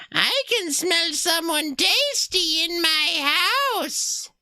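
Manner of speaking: a creepy little voice savouring the smell; high squeaky witch. Acted. Streamer voicing the game's witch, high and squeaky. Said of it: "a high, squeaky voice say".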